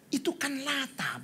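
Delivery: high, soft, slow protest; imitated meek excuse to the pastor; apologetic friend. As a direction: plead the excuse softly, pitched high.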